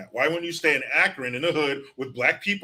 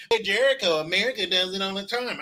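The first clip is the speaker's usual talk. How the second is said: higher, put-on voice of a smug critic; pitched-up jab from an imagined heckler; mock critic voice; 5 semitones higher than usual. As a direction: voice the know-it-all who argues back. Imagined critic talking back.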